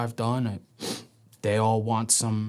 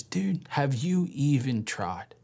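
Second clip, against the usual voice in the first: slow repeated challenge; 4 semitones higher, slower than usual. Retold or imitated.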